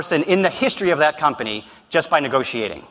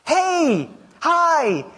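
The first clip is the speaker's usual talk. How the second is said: much higher, bubbly rapid-fire phone replies; mock young woman on the phone, much higher; imitating a twenty-three-year-old girl; 11 semitones higher, much slower than usual. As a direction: rattle off cheerful phone answers like a young woman. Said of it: "I'm gonna try to imitate one right now".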